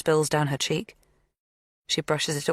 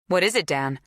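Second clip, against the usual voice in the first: sharp; 2 semitones higher than usual. A narrator's reading. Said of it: "Zoe's voice was sharp".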